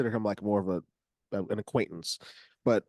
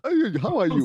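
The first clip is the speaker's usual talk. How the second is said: high, loud put-on Togolese accent; a quick Togolese accent greeting; 7 semitones higher, 7 dB louder than usual. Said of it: "how the Togolese accent would sound".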